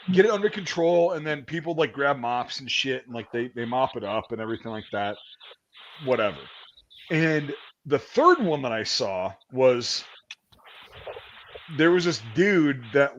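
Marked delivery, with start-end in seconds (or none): none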